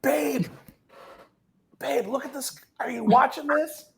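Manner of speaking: high, awed call to a partner; excited calling. Retold or imitated. Calling girlfriend to watch the show.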